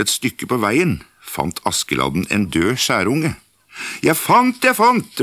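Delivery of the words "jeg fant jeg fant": high, loud, excited cry of a find; excited call. Acted. Askeladden calling his find to his brothers, high excited shout. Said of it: "ropte han".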